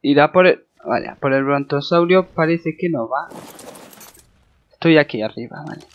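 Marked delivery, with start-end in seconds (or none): none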